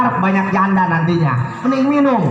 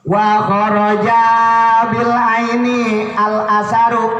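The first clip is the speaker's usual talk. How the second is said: kitab recitation; 3 dB louder, slower than usual. Acted.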